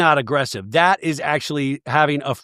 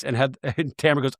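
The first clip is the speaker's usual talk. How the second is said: normal talking voice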